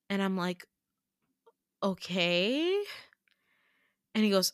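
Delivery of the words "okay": "Okay" stretched out with a long rising end; drawn-out, questioning "Okay"; puzzled. Retold or imitated. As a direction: say "Okay" slowly, sliding up into a question. Baffled reply to unknown term.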